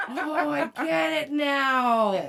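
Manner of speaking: very slow, high, loud imitation; drawn-out realization. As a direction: stretch it out as it dawns on him. Imitating his sudden comic realization.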